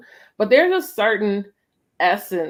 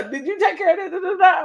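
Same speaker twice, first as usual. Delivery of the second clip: bossy daytime self; 13 semitones higher, 4 dB louder, much faster than usual. Retold or imitated. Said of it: "I'm like".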